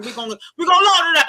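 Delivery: fast, loud, high; hyped shout. Real, not acted.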